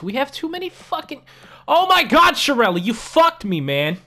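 high, loud exasperated yell at the GM; real frustrated outburst. Real, not acted. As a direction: blow up at the screen, cursing the GM. Frustrated gamer blows up at GM.

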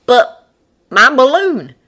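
loud, high protest. A narrator's reading. Man objects to abandoning his balloon, protesting. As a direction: burst out in objection.

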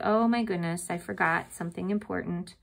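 normal talking voice

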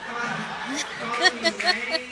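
high, loud, slow hammed-up taunt; mocking taunt. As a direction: sing it out like a playground jeer. Mimics the bully's name-calling.

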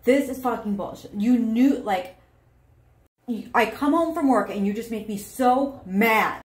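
furious partner coming home from work; angry outburst. Acted. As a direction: vent the frustration hard. Acted angry partner in demo.

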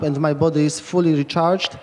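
ordinary talk

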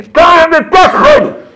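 high, loud yell; a child yelling back during a show, loud and high; kids shouting along. Retold or imitated. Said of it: "and I hear".

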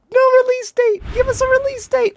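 high-pitched wail of protest; real fan's whine; excited pleading. Real, not acted. Playful wail at missing date.

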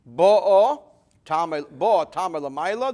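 Bo'o with the first syllable hit loud; stress on first syllable. Shown as a demonstration. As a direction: punch the opening "Bo" of Bo'o. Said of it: "the accent is on the first syllable".

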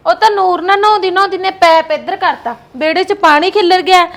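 loud defensive explanation; loud protest. Acted. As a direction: defend yourself loudly to your sister-in-law. Explains herself loudly to sister-in-law.

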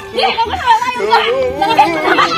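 put-on high kid voice protesting, ending in a squeal; whiny child. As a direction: protest to mama like a small child.

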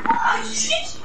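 high startled yell; sudden panicked outburst; panicking gamer. Real, not acted. Sudden setback in game.

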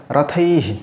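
"রথৈহি" with long oi, then short hi; visarga as hi. Shown as a demonstration. Teacher introduces visarga after oi, demonstrating pronunciation. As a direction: hold the oi in "রথৈহি", add a brief hi. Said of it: "এটা একটু different".

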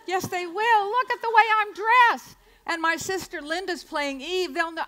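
put-on high, loud kid insisting; child protesting. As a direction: whine back at Mommy like a stubborn girl. Child arguing with mother.

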